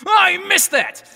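very high-pitched wail of complaint; mock outburst. Emperor who used to hear better, comically wailing. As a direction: yelp it in exaggerated frustration.

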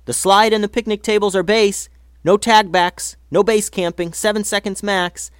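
rules called out high and loud, running; yelled rules. A narrator's reading. Kids shout the tag rules while fleeing, loud. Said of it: "The kids all yelled out the rules".

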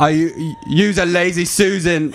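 Scottish attempt slipping into British; failed Scottish, British accent. Someone presenting their fridge, faked Scottish turning British accent. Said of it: "that's just British".

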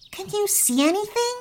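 put-on high, quick question in a boy character's voice; asking. A narrator's reading. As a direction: ask it quickly, pitched right up. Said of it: "Rami asked".

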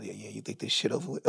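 low, soft, menacing roommate; low menacing mutter. Retold or imitated. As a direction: mutter it like he's lurking in the dark. Roommate lurking at bathroom door.

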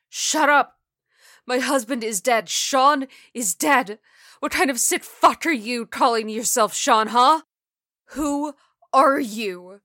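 high, loud anguished outburst; distraught shouting. Acted. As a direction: scream at someone posing as your dead husband. Widow screaming at impostor, fighting tears.

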